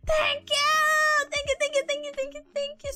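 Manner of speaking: high, gushing thank-yous; excited thanks. Real, not acted. Streamer thanking viewers for eight months of support, gushing. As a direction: pour out thanks to chat.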